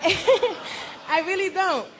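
slow, laughing through words. Real, not acted. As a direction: laugh it out slowly. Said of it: "I don't talk like that".

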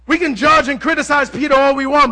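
usual speaking voice

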